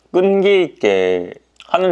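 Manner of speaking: biting and chewing; speaking mid-bite; mouth full of bread. Real, not acted. Seen 1: Bites into filled bread 0.1-1.6, then holds it near mouth.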